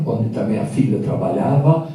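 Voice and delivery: ordinary delivery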